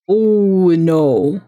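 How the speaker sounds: loud groaning oh no; dreading what comes next; loud dismayed oh no. Real, not acted. Player dreading the dark room.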